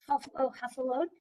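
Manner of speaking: plain reading voice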